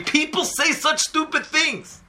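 slow, high, exasperated complaint; a father fed up with foolish words; hammed-up high bitter lament; bitter grieving father. Grieving friend vents his anger.

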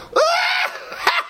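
long, very high shrieking cackle; mock funeral laughter. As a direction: burst into exaggerated laughter among mourners. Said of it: "Let's all start laughing".

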